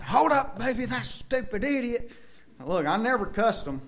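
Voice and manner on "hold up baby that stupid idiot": retold holler. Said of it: "I was hollering".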